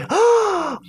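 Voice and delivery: sharp, high inward gasp; startled gasp. Shown as a demonstration. Teacher imitating someone who saw something scary, startled gasp. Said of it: "like, you're gasping".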